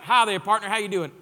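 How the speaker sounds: loud Texan billionaire greeting; a hammed-up Texas accent; Texas drawl impression. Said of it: "I don't know if that's how he talks".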